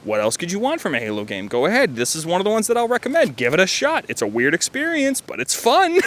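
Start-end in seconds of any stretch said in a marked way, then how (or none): 5.2-6.1 breaks into a high giggle; laughing. Real, not acted. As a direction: laugh it off, amused. Amused at weird fan game.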